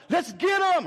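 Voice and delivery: high, loud mock battle cry; eager rallying cry. Church people charging after the boy, mock rallying cry. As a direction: whoop it like an overzealous crowd. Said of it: "Would there be people going".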